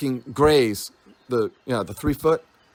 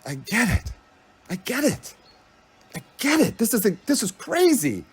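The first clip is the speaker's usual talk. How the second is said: each I get it climbs higher in wonder; dawning realization, building; rising amazement; 5 semitones higher than usual. Retold or imitated. Reliving the moment of realization, building excitement. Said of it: "I was like".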